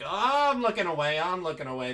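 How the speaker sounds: retold squeamish protest, jumping high at the start; imitated squeamish reaction. Telling friends about a face melting off, mock squeamish. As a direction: re-enact recoiling from a gory sight, easing off quickly. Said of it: "and I'm like".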